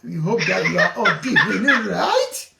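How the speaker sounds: high, loud laughing teasing of the caller; giggling while greeting the Glasgow caller. Real, not acted. Host laughing at caller's claimed British accent. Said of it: "British accent? From Glasgow?".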